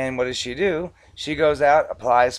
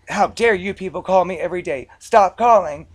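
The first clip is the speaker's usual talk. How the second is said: mock outrage, pitched up and building louder; upset caller; 4 semitones higher, 6 dB louder than usual. Upset debtor calling in to the bank, loud indignant protest. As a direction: imitate an indignant customer telling someone off. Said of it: "they'd be upset".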